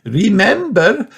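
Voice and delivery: high, slow sing-song teacher voice; Madame Gazelle. Acted. Teacher reminds the children, lilting and high. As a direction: coax the children like a gentle French teacher.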